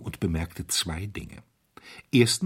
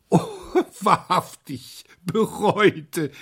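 high, loud mocking laughter; young man roaring with laughter; laughing loudly; 11 semitones higher, 4 dB louder, much slower than usual. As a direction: laugh the word „bereute“ out in disbelief. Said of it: "mit schallendem Gelächter".

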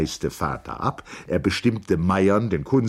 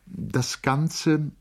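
lightly voiced higher-pitched woman's part; Charlotte; 9 semitones higher than usual. A narrator's reading. Charlotte opens her reply to August, lightly voiced in higher pitch. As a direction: read her line a little higher.

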